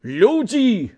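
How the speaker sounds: pleading shout to a crowd; crying out. A narrator's reading. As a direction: call out to the people. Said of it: "крыкнуў Мусатаў".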